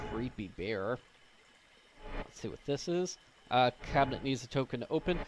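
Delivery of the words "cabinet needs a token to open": usual speaking voice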